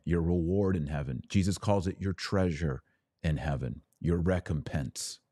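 ordinary talk

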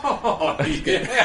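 laughing out high. Real, not acted. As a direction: laugh through the words. Laughing at friend stripping.

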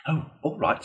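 lightly voiced eager Toad; readily. A narrator's reading. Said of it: "said the Toad readily".

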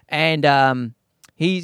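ordinary talk, nothing marked

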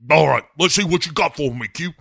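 loud, fast, hammed-up hero voice; Bond. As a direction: rattle it off with cocky force. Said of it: "Bond just is like".

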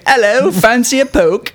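mock Cockney.

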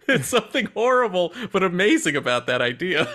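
laughing through the words; giggling while talking. Real, not acted. Laughing at a grim idea.

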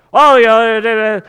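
loud, drawn-out mock agreement; mock accusing. Preacher parodying bad evangelism, mock accusing. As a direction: lean hard on a sarcastic opener. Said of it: "You sit there and say".

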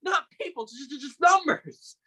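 venting, building on repeated numbers; bitter rant. Acted. Brother rants about students being just numbers, bitter and stammering.

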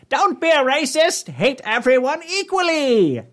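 high, slow cartoon villain screech; Skeletor. Reading Satan's tweet aloud, high villain screech. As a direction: proclaim it high, stretching every phrase.

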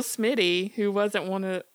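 ordinary delivery

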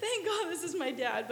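embarrassed relief that spills into laughs; laughing mortified. Retold or imitated. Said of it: "And I'm like".

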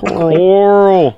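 loud, high, drawn-out southern holler; terrible southern accent. Acted. Podcaster mimics an actor calling Carl, terrible southern accent. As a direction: bellow it in a hammed-up southern accent. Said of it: "his terrible southern accent".

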